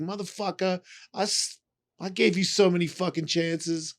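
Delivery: hammed-up outrage at him; mock angry reproach. The people he hurt telling him off, mock angry.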